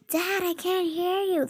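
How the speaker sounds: high, strained cry to dad; a high, panicked plea over the phone; panicked call. Retold or imitated.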